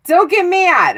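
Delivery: high, loud burst; loud mock-insistent plea; emphatic playful warning. Same warning, now forceful.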